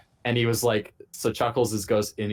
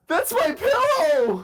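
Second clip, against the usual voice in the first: high, loud, slow, cracking up through it; laughing shout; 5 dB louder, much slower than usual. Retold or imitated. Bunkmate in on the prank claims the pillow, laughing shout. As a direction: blurt it out while laughing.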